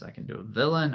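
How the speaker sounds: ordinary delivery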